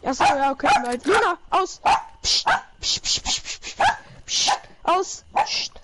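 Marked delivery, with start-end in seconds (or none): 1.0-1.8 sharp, high call to the dog; stern command to the dog Luna. Real, not acted. 2.2-5.7 voiceless psst at the dog; hushing hiss. Real, not acted.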